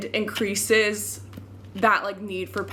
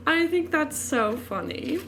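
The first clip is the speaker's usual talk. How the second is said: normal talking voice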